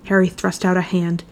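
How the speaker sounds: plain reading voice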